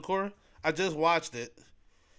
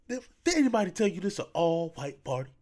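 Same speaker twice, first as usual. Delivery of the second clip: slightly raised, punchy movie-bully delivery; Terry Crews impression; 4 semitones higher, 3 dB louder than usual. Vlogger shows off his acting with a movie quote, lightly put-on voice. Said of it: "I'm an okay actor".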